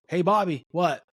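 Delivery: curt, high "what?"; mock rude answer to a teacher; rude "what?" reply. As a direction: snap back a careless "what?". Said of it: "their teacher go".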